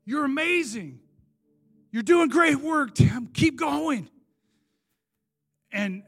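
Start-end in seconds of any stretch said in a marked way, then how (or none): 0.1-4.1 rising into an exuberant near-shout; joyful encouragement. Retold or imitated. Jesus cheering on the prisoner in his cell, exuberant shout. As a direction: cheer him on like a proud coach.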